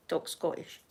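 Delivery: a conspiratorial whisper to her family; whispered aside. Shown as a demonstration. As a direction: softly whisper Talk Scottish as a secret. Family told: talk Scottish.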